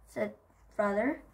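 ordinary delivery, nothing marked

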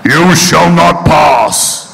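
booming, drawn-out wizard proclamation; Gandalf. Acted. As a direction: stretch out every word like a commanding wizard. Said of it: "I'm practically Gandalf".

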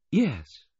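high, reserved woman's voice. A narrator's reading. Missus Baudelaire replying to his good wish, high and reserved. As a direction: answer high, holding something back. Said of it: "There was reservation in her voice".

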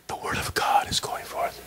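a breathy whisper, no voice at all; dramatic whisper. Acted. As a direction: whisper it like a revelation. Dramatic sermon moment.

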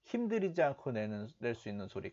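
normal talking voice

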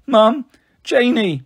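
put-on high, drawn-out child's wail; tearful wail. A narrator's reading. Frightened boy calling for mum and sister, wailing. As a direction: call out slowly like a crying little one. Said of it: "starting to cry".

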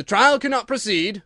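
loud, high, solemn pronouncement; weighty royal ruling; very grave. A narrator's reading. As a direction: declare it gravely to the whole court. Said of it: "in a very grave voice".

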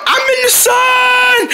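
slow, very high drawn-out call; soaring shout. Pretend. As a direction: stretch it out high like a soaring kite. Pretend kite flying up high.

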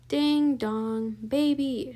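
loud, drawn-out call; shout. A narrator's reading. Eugenia shouting for Baby, a loud call. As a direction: call out loudly, stretching the words. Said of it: "shouted Eugenia".

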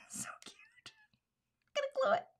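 high whispered delight; whispered coo. Real, not acted. Crafter cooing over her card in a whisper.

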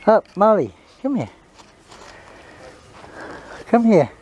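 high coaxing commands; calling the dog. Real, not acted. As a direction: call the dog over firmly.

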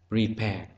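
"Prepare" stressed on its second syllable. Shown as a demonstration. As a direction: put the weight on "-pare" in "Prepare". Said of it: "rơi vào âm tiết thứ hai".

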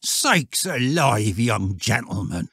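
put-on startled old shepherd, drawn out; mildly alarmed rustic exclamation. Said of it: "in a startled voice".

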